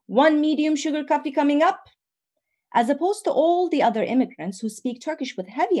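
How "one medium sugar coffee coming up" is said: lightly raised voice calling across the shop. Retold or imitated. Tea boy arriving at the bakery, calling out. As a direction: call the order out cheerfully. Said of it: "calling out".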